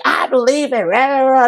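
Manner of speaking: high, whiny mock sob; hammed-up teary whimper; mock sobbing self. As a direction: blubber it out like a crying kid. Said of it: "And I'm like".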